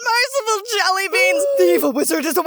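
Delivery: high-pitched wailing panic; hammed-up crying and shouting in horror; mock terror. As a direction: cry out in exaggerated dread. Hammed-up panic at the scary scene.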